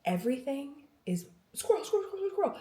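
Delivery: playful squeak. Pretend. Mimics her scattered mind.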